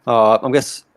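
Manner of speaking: usual speaking voice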